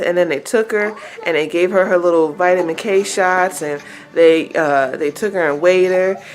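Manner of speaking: ordinary delivery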